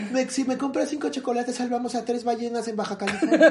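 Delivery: high, sugary little scout pitch; girl scout selling cookies. Retold or imitated. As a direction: chirp it like a kid selling chocolates door to door. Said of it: "me parece que vendes galletas".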